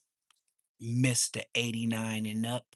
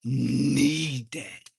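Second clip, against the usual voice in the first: louder insistent repeat, leaning on need; emphatic repeat; 5 dB louder than usual. Real, not acted. Repeating himself to the camera, louder and insistent. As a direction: hit need hard and loud the second time. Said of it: "And I mean".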